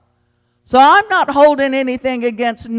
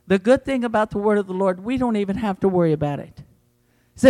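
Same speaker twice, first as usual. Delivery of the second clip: ordinary delivery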